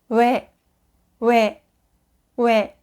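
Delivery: ordinary talk, nothing marked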